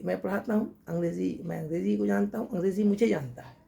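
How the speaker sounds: normal talking voice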